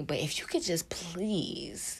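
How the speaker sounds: hushed, unvoiced plea; mock begging whisper; pleading whisper. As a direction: whisper the favor sheepishly. Begging God for money, playful.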